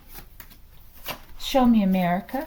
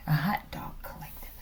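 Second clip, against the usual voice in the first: breathy joking whisper, much softer; whispered punchline to the viewers; 3 semitones lower, 8 dB quieter than usual. Real, not acted. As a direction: let the joke slip out under your breath.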